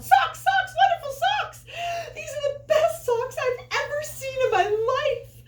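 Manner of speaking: high excited yell. A narrator's reading. Tina yelling to her family about new socks. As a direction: shout it out in pure joy. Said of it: "Tina ran home and yelled".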